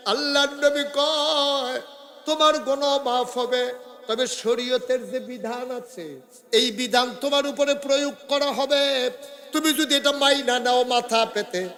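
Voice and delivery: high-pitched chanting sermon delivery; impassioned preaching. A narrator's reading. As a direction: preach it high and urgent, holding line endings. Preacher chants the Prophet's verdict. (সুরেলা উঁচু গলায় ওয়াজ)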